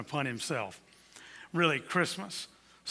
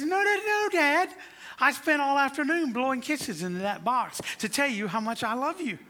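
put-on much higher, eager little girl's voice; 10 semitones higher, 4 dB louder than usual. Little girl telling her dad the box is full, high and eager. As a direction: speak as a five-year-old pleading with her dad.

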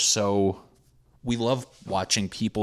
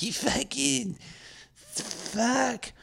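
mock reality-show scream, much higher pitch; drunk screaming fight; 4 dB quieter, much slower than usual. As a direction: shriek it like a drunk dinner brawl. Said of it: "just screaming at each other".

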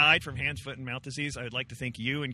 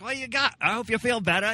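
high, hammed-up bad Australian accent; a deliberately awful Australian accent; terrible Australian; 8 semitones higher, 3 dB louder than usual. Comic imitating Will Anderson wishing him well, bad Australian accent. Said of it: "I do a terrible Australian".

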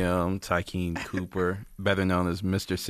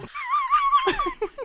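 high laugh. Real, not acted. Guest laughs at own joke.

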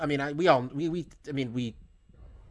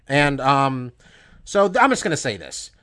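ordinary delivery

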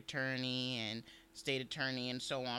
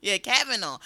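ordinary talk

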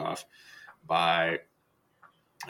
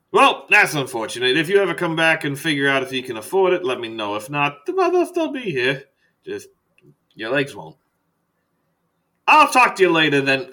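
breezy doctor; 5 semitones higher, 6 dB louder than usual. Acted. Doctor sending off a patient who can't pay, breezy. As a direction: perk up loud and brisk.

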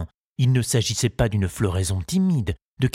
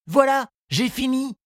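lightly voiced young boy, pitched high and eager; a boy's light high voice, keen to finish; Tistou's voice. Tistou reassuring his gardening teacher, in a high boyish voice.

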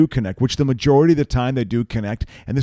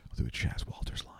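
unvoiced whisper, much softer; 9 semitones lower, 16 dB quieter than usual. Real, not acted. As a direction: hush it right down before the bit. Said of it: "cleanse the palate".

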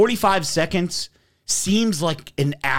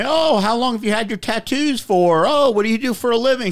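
higher, bright mock nurse voice, fast at the end; a nurse making small talk with a patient, imitated higher and quick; chirpy nurse small talk; 10 semitones higher than usual. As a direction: imitate the nurse chatting him up. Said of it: "she's making small talk with me".

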